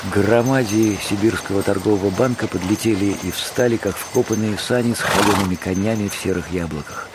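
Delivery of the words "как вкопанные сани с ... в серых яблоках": ordinary delivery, nothing marked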